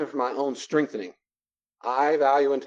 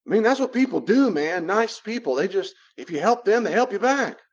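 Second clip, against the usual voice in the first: high-pitched imitation of someone justifying themselves; defensive protest; 8 semitones higher, 3 dB louder than usual. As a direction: argue your case in a higher, insistent voice. Acting out the defensive other person.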